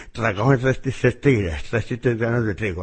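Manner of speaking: "Tragaban tres tristes tigres" mumbled around a pen, tongue blocked; pen in mouth. Shown as a demonstration. Coach repeating the twister with a pen in his mouth, muffled. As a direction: say the twister with a pen clamped in the teeth. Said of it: "Te pones la pluma en la lengua".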